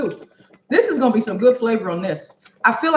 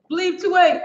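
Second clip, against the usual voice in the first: usual speaking voice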